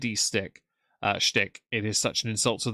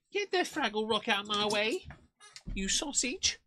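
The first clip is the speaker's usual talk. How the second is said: high-pitched, softer telling-off of a pet; playful scolding; 11 semitones higher, 6 dB quieter than usual. Real, not acted. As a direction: scold the dog in a high silly voice. Playfully scolding the dog, off mic.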